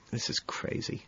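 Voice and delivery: whispered under the breath; a barely voiced aside; muttered. A narrator's reading. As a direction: breathe it out to yourself. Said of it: "And I mutter".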